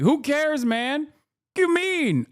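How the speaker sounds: very high, incredulous complaint; exasperated outburst. Real, not acted. Streamer objecting to an Israeli take on Michigan, high exasperated outburst. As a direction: protest way up high, as if baffled.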